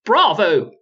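high, eager cry of bravo; excited shout. Acted. Wizard cheering the storyteller, excited shout. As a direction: burst out in delight. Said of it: "shouted the wizard".

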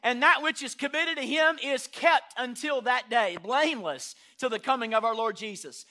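loud, high, ringing sermon delivery; full-force preaching. Acted. Pastor preaching at full force to the congregation. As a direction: preach it to the rafters.

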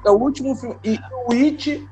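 ordinary talk, nothing marked

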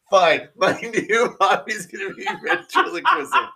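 loud, high cackle spilling into the words; laughing too hard. Real, not acted. Teased as a ventriloquist, he concedes while laughing hard. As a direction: give in through helpless laughter. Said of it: "I'm laughing way too hard".

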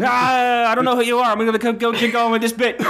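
high, embarrassed voice cutting the bit; high flustered tracksuit dealer voice. Acted. Dealer flustered and cutting his own bit. Breaking character voice.

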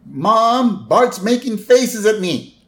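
put-on high, whiny tattling kid; Lisa. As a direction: call to Mom to complain. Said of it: "Lisa's like".

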